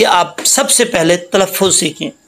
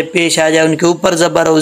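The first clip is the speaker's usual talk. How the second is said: usual speaking voice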